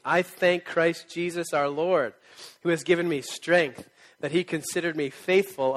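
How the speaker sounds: slow, loud, solemn reading; scripture reading. Acted. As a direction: read it out like scripture to a church. Reads scripture aloud to the church.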